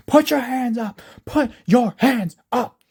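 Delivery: high, loud command at a suspect; shouted police order. A narrator's reading.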